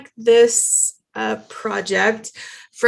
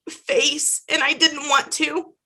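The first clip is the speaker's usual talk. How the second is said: ordinary delivery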